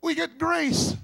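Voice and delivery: loud, climbing preacher's cry; fiery preaching. Acted. Preacher rousing the congregation about God's love, loud and fervent. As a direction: build the sermon to a rousing peak.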